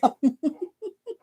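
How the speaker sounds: quiet, high breathy giggle; soft high laugh. Real, not acted. Still laughing at the tough sergeant, soft high giggle. Laughs again after "but damn.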